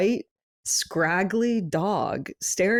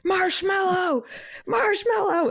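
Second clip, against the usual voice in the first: high, rising calls for a lost dog, each name higher; retelling strangers calling for a runaway dog; 12 semitones higher, slower than usual. Retold or imitated. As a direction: call the dog's name across the yard. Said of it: "people shouting".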